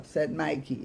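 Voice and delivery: plain reading voice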